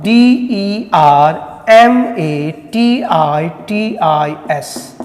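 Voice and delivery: slow letter-by-letter spelling. Shown as a demonstration. Teacher spells a new word for students, slow letter by letter. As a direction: spell it out slowly, each letter separate.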